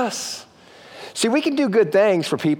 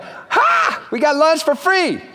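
gleeful cry; 9 semitones higher, much slower than usual. Pretend. Churchgoer finds a twenty on his windshield, gleeful whoop. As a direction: shout it like you just found free lunch. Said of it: "you're like".

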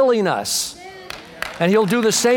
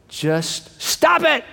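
slow, higher deadpan catchphrase; Bob Newhart; 8 semitones higher, much slower than usual. Retold or imitated. Preacher rebuking revenge with the comedian's line, slow. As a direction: stretch each word like a dry rebuke. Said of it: "I love Bob Newhart's counsel".

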